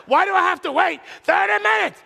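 high, loud, indignant yell; mock angry shout. Pastor acts out his ticked-off complaint, high and loud. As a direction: bellow like a spoiled customer. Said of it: "I'm really ticked off".

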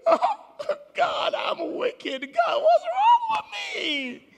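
slow, hammed-up wail of guilt, laughing; mock self-reproach. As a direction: wail it out like a sinner caught. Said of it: "you say".

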